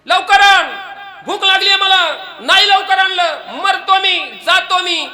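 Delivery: high, loud, hammed-up desperation; comic bellowing husband; frantic hungry shouting. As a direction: yell as if starving to death. Starving husband yelling at his wife.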